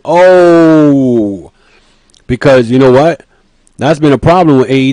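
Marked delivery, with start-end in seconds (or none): 0.0-1.5 a drawn-out crowd ooh, mimicked; imitated crowd oh. Imitating the shocked arena crowd with a mock ooh. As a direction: let out the crowd's stunned ooh. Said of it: "the whole crowd went".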